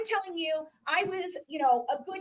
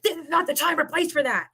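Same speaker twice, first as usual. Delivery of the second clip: mock stern man; 6 semitones higher, 7 dB louder than usual. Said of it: "He's like".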